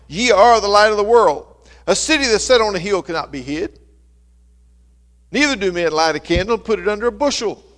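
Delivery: ringing, loud King James reading; scripture reading. Acted. Preacher reading Matthew to his congregation, proclaiming loudly. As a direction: proclaim the verses loud to the hall.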